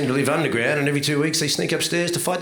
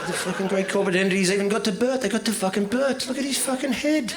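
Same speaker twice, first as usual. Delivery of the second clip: higher, incredulous rant, laughing through it; mock outrage; 7 semitones higher than usual. Comic ranting at the audience about Bert's face. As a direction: pile on the disbelief.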